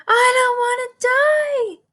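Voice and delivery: very high, loud piglet scream; a pig throwing himself down in despair. A narrator's reading. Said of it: "screamed Wilbur".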